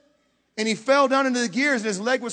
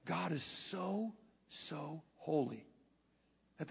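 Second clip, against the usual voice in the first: very slow, much softer, almost breathless; hushed awe; 5 semitones lower, 14 dB quieter, slower than usual. Acted. Preacher hushes the congregation, slow and reverent. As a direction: drop to a reverent hush and draw it out. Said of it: "God, watch this".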